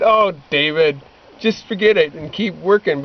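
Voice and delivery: ordinary talk, nothing marked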